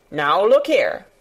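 a mild, understated sharp warning; sharp rebuke. Acted. Old gardener turns on the curious girl, mildly sharp. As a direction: cut in gently sharp, a touch stern. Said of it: "he said sharply".

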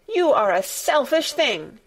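high-pitched indignant accusation; mildly heated outburst; crying out. A narrator's reading. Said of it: "cried Colin".

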